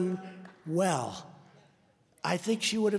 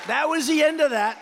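much higher, louder triumphant shout; shouted punchline; 10 semitones higher, 5 dB louder than usual. Real, not acted. Rally speaker hammering home the win to the crowd, triumphant shout. Punchline repeated again, loud.

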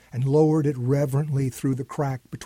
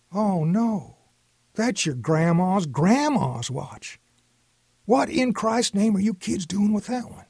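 higher, slowed dismay building; aghast at what they took; dismayed grandpa; 6 semitones higher than usual. A narrator's reading. Grandpa realizing it is the heirloom watch, higher dismay.

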